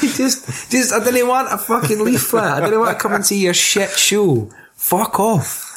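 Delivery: high-pitched comic protest; hammed-up annoyed refusal; mock exasperated passer-by. Acting a pestered festival passer-by.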